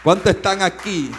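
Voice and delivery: loud call to the crowd; preacher rallying the church. Real, not acted. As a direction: call out to the congregation. Preacher calls to congregation.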